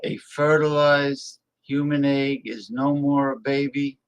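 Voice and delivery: ordinary talk, nothing marked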